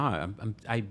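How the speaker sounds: ordinary delivery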